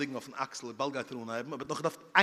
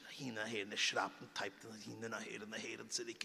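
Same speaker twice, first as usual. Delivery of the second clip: quiet singsong; 5 semitones lower, 12 dB quieter than usual. Acted. Acting out rocking motion.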